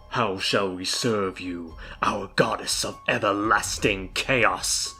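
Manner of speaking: slow, low servile voice; low, entranced devotion; possessed minion. Acted. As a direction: intone it slowly, as if possessed. Couple possessed by evil serve goddess.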